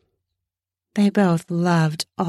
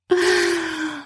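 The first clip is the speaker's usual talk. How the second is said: gasp; 11 semitones higher than usual. Acted. Pleasure gasp in sex scene.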